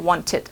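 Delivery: "wanted" as WON-tid, ending short and light; half-sound ending. Shown as a demonstration. Teacher correcting Thai learners, half-sound ending. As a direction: keep the last syllable of "wanted" reduced to half a sound. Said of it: "เอาครึ่งเสียงพอ wanted".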